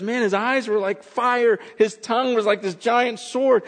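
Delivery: high, excited retelling; acted wide-eyed astonishment; awestruck witness. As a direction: gush in amazement as John describing the vision. Acts John awestruck by the vision.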